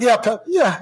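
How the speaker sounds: high, feigned tearful reply; fake weepy. Player fake-crying in the stall to his coach, high and weepy. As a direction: play the hurt kid, hammed-up. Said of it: "I'm like".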